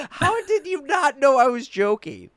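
high, loud, incredulous protest; mock exasperation. Retelling a defence to the offended stepmom, exasperated. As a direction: drag it out in exasperated disbelief.

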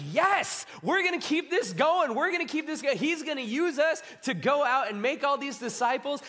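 high-pitched, breakneck enthusiasm; pumped up and repeating for joy; excited disciple. Acted. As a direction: burst with thrilled, high energy. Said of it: "the excitement they were all feeling".

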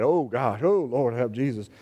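low, groaning mock despair; a deep moaning "help Jesus"; mock dread.

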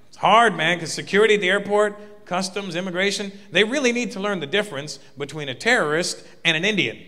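usual speaking voice

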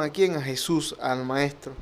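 ordinary delivery

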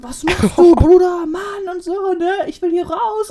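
high-pitched mock screaming at someone; hammed-up imitation of an angry friend; imitated yelling. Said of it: "Sie hat immer diesen Skityp dann so angeschrien".

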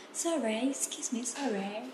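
soft, polite British-accented sorry; British apology. Shown as a demonstration. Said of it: "bilang sorry sering". (minta maaf pelan logat British)

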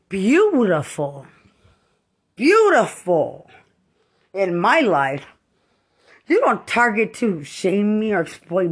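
screaming it at the person targeting her; furious shouting. Real, not acted. Furious rant at a perceived persecutor.

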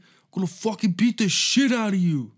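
hammed-up furious yell; mock angry threat. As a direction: bark out the threat like a raging dad. Angry threat quoted, loud.

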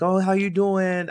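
put-on a quick Jamaican-accented hello from grandma; brief playful Jamaican accent; attempted Jamaican accent. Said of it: "I would try to Jamaican accent".

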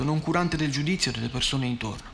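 ordinary delivery

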